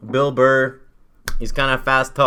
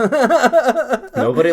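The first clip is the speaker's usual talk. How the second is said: laughing while talking; 14 semitones higher, 8 dB louder, much slower than usual. Real, not acted. Laughing at joke.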